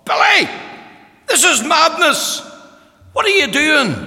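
high, loud, scandalised voice of the visiting lady; shocked woman's cry. Retold or imitated. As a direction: cry out in disbelief at the grieving man. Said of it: "she thought".

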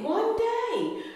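high sung-out "One day."; soaring echo. Shown as a demonstration. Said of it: "So one day God did it".

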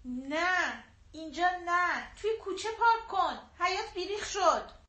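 calling out. A narrator's reading. Mother calling from the porch to the driver, mildly bossy. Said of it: "داد زد".

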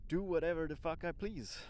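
loud, high and defiant; defiant exclamation. Shown as a demonstration. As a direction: throw it out like a carefree boast. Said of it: "Sounds wrong".

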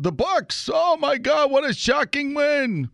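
high-pitched put-on astonishment; mock shock. Sports host mimicking everybody else's reaction to the win, mock shocked. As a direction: act hugely shocked, pitch soaring well above normal. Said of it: "I'd like to say, like everybody else".

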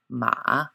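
scoop "ma" down and back up; third tone. Shown as a demonstration. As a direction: Mandarin third-tone "ma", dipping pitch. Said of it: "a dipping note".

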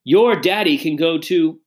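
mildly venomous snap; spat out. A narrator's reading. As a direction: spit the words at the child. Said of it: "spat Missus Tifton".

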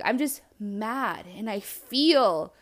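very slow, word by word; emphatic venting. Retold or imitated. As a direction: drag out each admission of being mad. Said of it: "was like".

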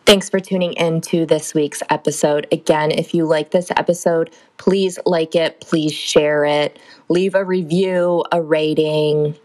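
loud, slow announcer sign-off; podcast outro. Acted. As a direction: read the outro like a host.

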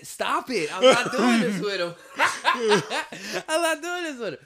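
high, loud laughing through the words; breaking up while refusing to go on; laughing protest. Real, not acted. Laughing hard as he begs his friend to stop.

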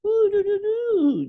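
very high, loud coo to a dog; piercing pet-greeting baby voice; baby-talk cooing. Retold or imitated. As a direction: squeal nonsense syllables at a new puppy. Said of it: "the baby voice that people put on".

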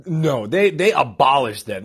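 ordinary talk

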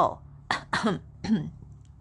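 short coughs acting out the word; illustrative little coughing fit; demonstrated cough. Shown as a demonstration. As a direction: cough to show what késou means. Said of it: "เขอโซ่ หมายถึงไอนั่นเองค่ะ".

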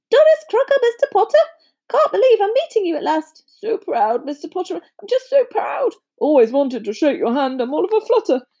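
high-pitched, fluttery fan voice; excited, overawed Doris character; flustered admirer. Acted. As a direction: gush with pride at meeting a hero.

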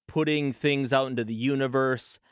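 ordinary delivery

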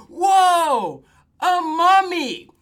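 loud, high, drawn-out exclamation; big slow comic cry of shock; mock alarm. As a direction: holler it in hammed-up amazement. Acting boys alarmed at a mummy.

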